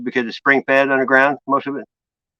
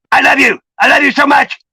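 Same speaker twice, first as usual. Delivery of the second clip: yelling I love you, higher and louder; loud angry-sounding love; 11 semitones higher, 5 dB louder than usual. Shown as a demonstration. To his wife, love words barked like an argument. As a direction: shout the sweet words like a fight. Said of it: "it's how you say it".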